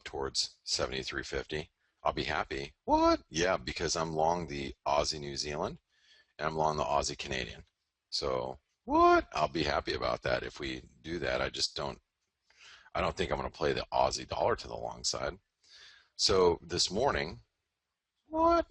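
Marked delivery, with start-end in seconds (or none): 2.9-3.2 very high, loud squealed disbelief; squeaky mock surprise. Mocking his dollar call in a squeaky voice. 8.1-9.3 higher, louder mock so what; high mock challenge. Answering his own imagined heckler, high and loud. 18.3-18.7 very high, loud squealed disbelief; squeaky mock surprise. Mocking his dollar call in a squeaky voice.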